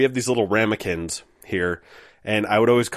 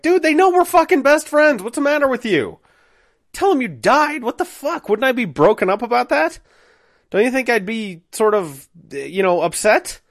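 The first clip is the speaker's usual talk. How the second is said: high-pitched, indignant tirade at a friend; exasperated outburst; 13 semitones higher, 4 dB louder than usual. Retold or imitated. Narrator to best friend who bailed, indignant. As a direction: argue back in a high, aggrieved voice.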